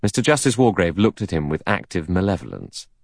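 usual speaking voice

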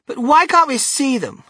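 high, loud, complaining question; demanding girl. Acted. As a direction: whine for a look. Spoilt girl demands to stop.

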